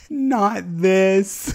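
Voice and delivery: high, loud pleading no; horrified protest. Real, not acted. To the game over the villager, high loud horrified plea. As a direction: beg the game to stop.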